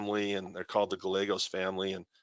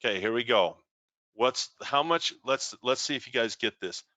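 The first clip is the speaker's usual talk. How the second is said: usual speaking voice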